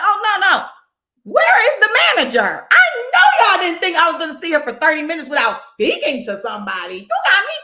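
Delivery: loud, high angry retold rant; demanding the manager. Fed-up customer demands the manager at the window, loud and high. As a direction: raise your voice and demand the manager.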